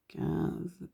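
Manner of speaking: counting bills under her breath, barely voiced; whispered counting. Real, not acted. Counting cash alone at her desk, she whispers the numbers.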